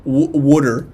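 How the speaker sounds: "water" said as "wooder", the South Philly way; Philly accent. Shown as a demonstration. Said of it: "The way you say water is brilliant".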